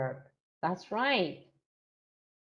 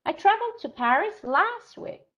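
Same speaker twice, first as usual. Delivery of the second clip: much higher, louder, exaggerated traveled; stress on traveled; 11 semitones higher, 6 dB louder than usual. Shown as a demonstration. Teacher hamming up the stressed word for students. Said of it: "Which word is more important to me".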